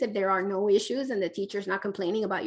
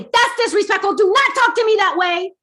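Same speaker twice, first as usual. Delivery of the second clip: loud, high, sharp reprimand; stern scolding; 11 semitones higher, 11 dB louder than usual. Retold or imitated. Parent scolding a sassy child, loud and sharp. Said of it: "If you say".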